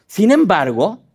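normal talking voice

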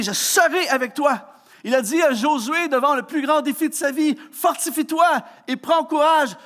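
fervent preacher's refrain; preaching. Acted. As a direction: drive the point home to the congregation. Preaching at rising force.

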